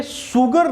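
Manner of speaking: 'शुगर' said with a plain s, 'soo-gar'; hard s at the start of 'शुगर', not sh; regional mispronunciation. Shown as a demonstration. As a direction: say 'शुगर' as 'sugar' with an s sound. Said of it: "UP में बोलते हैं शुगर".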